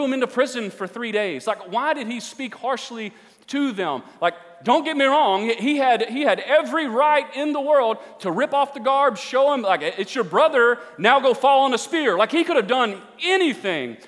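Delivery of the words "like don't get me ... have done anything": loud, high-pitched preacher; forceful preaching. Acted. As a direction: drive it home, stretching the key words.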